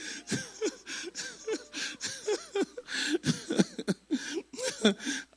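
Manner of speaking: high, quiet, bubbling laughter; a drawn-out run of soft chuckles; helpless high chuckling; long laugh. Real, not acted. Speaker laughs at own story.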